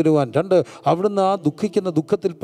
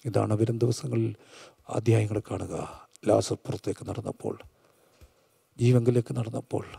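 low, soft, with long pauses; hushed preacher storytelling; 8 semitones lower, 8 dB quieter than usual. Acted. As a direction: tell it gravely, almost in a whisper. Hushed dramatic storytelling in sermon.

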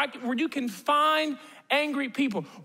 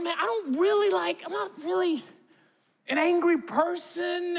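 inner protest; 5 semitones higher than usual. Retold or imitated. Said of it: "And I'm thinking".

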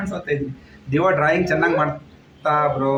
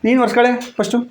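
louder and higher, firm parental orders; loud scolding; 7 semitones higher, 6 dB louder than usual. Real, not acted. Parent telling the wet kids to dry their heads, loud and firm.

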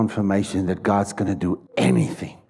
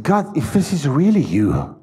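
desperate plea; 4 semitones higher than usual. Retold or imitated. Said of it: "and he said".

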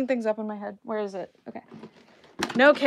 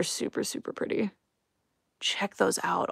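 whispered; 11 dB quieter than usual. Real, not acted. Admiring the boots.

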